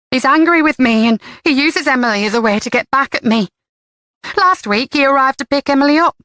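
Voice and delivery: a hint of a defiant mother rambling on; defensive mother. A narrator's reading. Jenny justifying herself to Karen, defensive and rambling.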